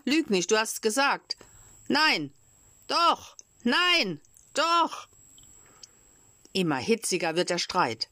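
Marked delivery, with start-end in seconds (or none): 0.0-5.1 rising, higher and higher yelling; childish tit-for-tat getting shriller; heated squabble. Acted. Quarrel escalating to shouting.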